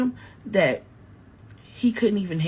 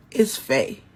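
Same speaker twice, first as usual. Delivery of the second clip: plain reading voice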